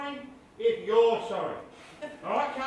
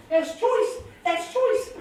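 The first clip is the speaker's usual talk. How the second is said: Kiwi slang; 6 semitones higher, much slower than usual. Acted. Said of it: "I'm a Kiwi".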